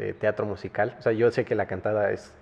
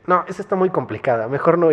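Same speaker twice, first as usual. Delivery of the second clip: exasperated teacher; 6 semitones higher, 5 dB louder than usual. Retold or imitated. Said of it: "Y me dice".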